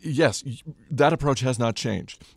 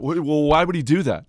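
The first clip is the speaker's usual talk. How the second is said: pitched-up, louder mock disbelief; dumbfounded reporter; 6 semitones higher, 6 dB louder than usual. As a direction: play a baffled reporter challenging the answer. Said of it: "some of them were flat out dumbfounded".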